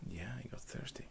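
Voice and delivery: very soft, breath-only repeat; whispered afterthought; hushed aside. Real, not acted. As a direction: drop it to a whisper.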